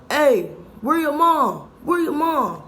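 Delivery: loud, high, slow calling out for mom; stranger at window. Retold or imitated. Guy at the window asking.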